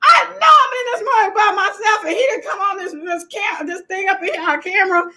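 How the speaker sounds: high, excited retelling of the fright; mock-panicked retelling of the shock; retold alarm. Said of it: "I was like".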